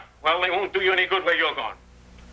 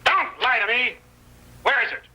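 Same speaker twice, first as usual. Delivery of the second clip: sharp, raised, higher demand for the gold; angry demand; 6 semitones higher than usual. Acted. Robber threatens the old prospector, angry demand. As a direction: bark it at him, losing patience.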